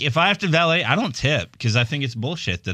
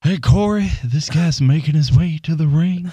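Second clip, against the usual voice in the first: put-on raised Bray Wyatt Southern accent; 3 semitones higher, 3 dB louder than usual. Announcer calling an entrance to Corey, Bray Wyatt accent. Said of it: "the Bray Wyatt accent".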